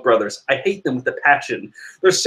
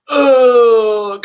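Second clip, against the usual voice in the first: crying groan; 8 semitones higher, 7 dB louder than usual. Retold or imitated. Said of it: "Judge is just going".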